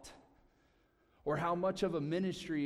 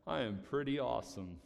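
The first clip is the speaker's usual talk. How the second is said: mild self-satisfied brag; a hint of a proud churchgoer; mock boastful voice; 3 semitones lower than usual. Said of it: "voice changing everything".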